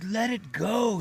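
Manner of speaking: high, drawn-out urging; coaching rooster. Acted. Rooster coaches the egg layer.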